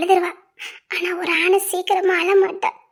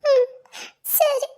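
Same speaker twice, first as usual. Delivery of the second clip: higher, tearful murmur of assent; 6 semitones higher, slower than usual. Acted. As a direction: agree while holding back crying. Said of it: "முத நீ அழுவே நிப்பாட்டு".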